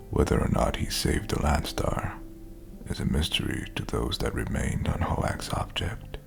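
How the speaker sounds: ordinary talk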